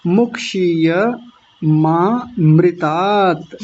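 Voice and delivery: slow मुक्षीय मामृतात with ya and long taat; correct, drawn out. Shown as a demonstration. Teacher modelling the ending, slow full मुक्षीय मामृतात. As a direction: separate maa-mri-taat after मुक्षीय in मुक्षीय मामृतात. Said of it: "ऐसा बोलेंगे".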